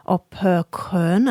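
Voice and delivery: "Oper Köln" said slowly, the German way; German pronunciation. Shown as a demonstration. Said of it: "I think is how we pronounce it in Germany".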